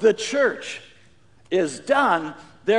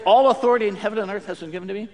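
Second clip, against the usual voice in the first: rushed rote recital; 4 dB louder, faster than usual. Shown as a demonstration.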